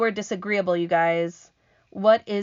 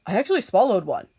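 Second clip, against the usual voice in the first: proud; 3 semitones higher, 6 dB louder, faster than usual. Acted. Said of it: "the earthworm declared proudly".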